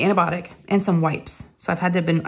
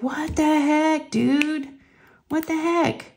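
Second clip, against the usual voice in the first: high, drawn-out disbelief; mock exasperation; 9 semitones higher, much slower than usual. Owner scolding the dog for wetting the bed, mock exasperation. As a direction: stretch it out in a high exasperated whine. Retold reaction to soaked bed.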